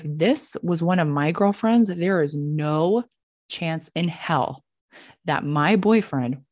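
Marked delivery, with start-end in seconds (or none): none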